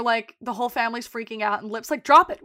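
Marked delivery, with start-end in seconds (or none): 2.0-2.4 louder, higher sharp command; angry snap. Retold or imitated. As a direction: cut them off hard. Lip snapping at family.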